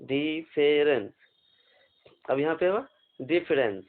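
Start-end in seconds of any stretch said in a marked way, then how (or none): 0.0-1.1 slow, clear model pronunciation; slow model word. Shown as a demonstration. Teacher modelling the word for students, slowly. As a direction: say it slowly for them to repeat. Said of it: "ठीक है".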